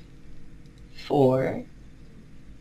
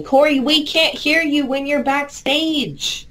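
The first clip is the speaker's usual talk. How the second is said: calling out to him; 8 semitones higher, slower than usual. Real, not acted. Calling to Corey backstage.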